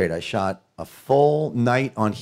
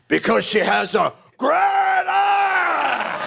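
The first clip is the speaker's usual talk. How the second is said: much higher, exploding shout on ass; Pacino-style scream; slower than usual. Retold or imitated. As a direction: blow up at the end of the line. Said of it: "it came out".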